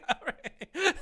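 high, loud helpless laughter; real laughing fit. Real, not acted. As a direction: break up laughing, words squeezed out high. Cracking up at the bit.

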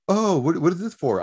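high, chirpy curious question; playing a friendly waitress.

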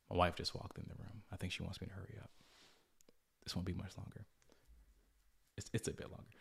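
dropping his voice so his wife in the room won't hear; hushed. Real, not acted.

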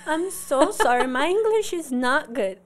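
pitiful apologizer. Shown as a demonstration. Student apologizing to Americans for poor English, mock pitiful. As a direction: plead in a put-on poor-me voice, drawn out at the end. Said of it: "faz a carinha de coitado".

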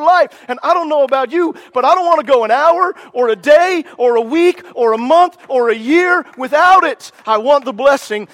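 urgent, fiery sermon delivery; preaching at full force. Real, not acted. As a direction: drive each line home like a revival preacher.

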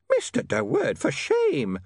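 high, shocked landlady scolding; scandalised prim landlady; shrill nagging landlady. A narrator's reading. Landlady voiced in story.

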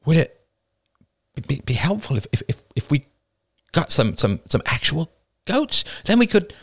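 high, hesitant stammer; timid suggestion. Acted. Woman suggests getting real goats, timidly. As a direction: stumble tentatively toward the idea.

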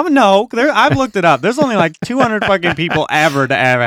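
high, loud, exasperated; indignant protest. Real, not acted. Defending his name to friends, high and indignant. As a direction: protest the name joke, rising.

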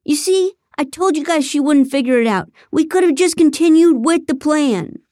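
high, loud exasperated shout; shouting at his partners in frustration; angry shout. Acted. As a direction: yell it at them, fed up. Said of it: "Ethan shouted".

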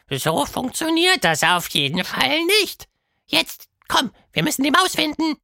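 sehr hoch, zum Schluss schnell; Quatschmonster, augenrollend. A narrator's reading. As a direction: genervt abwinken und antreiben. Verdreht die Augen. (Quatschhund drängt Tilda weiter)

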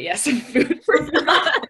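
slow laughing repeat. Real, not acted. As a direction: chuckle through it.

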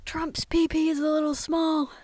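high, loud teasing jab; loud mocking. Real, not acted. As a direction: blurt it out gleefully.

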